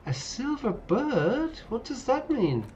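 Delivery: squeaky bewildered question; baffled. Real, not acted.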